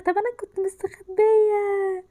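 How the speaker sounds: slow, tearful complaint; half-crying in frustration; whiny cry. Real, not acted. Gamer caught in her hiding spot, whining tearfully.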